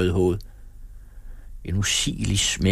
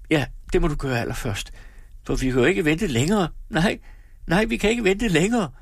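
rising to a high anxious wail; agitated lament; 9 semitones higher than usual. A narrator's reading. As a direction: build from urging to plaintive despair. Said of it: "klagede hun".

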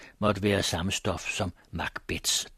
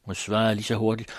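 ordinary delivery, nothing marked

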